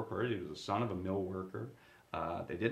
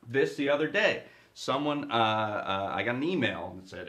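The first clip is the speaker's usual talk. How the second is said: ordinary delivery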